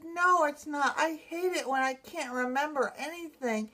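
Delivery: retold sobbing. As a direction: protest as if tearful, without crying. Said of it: "and I'm still sobbing".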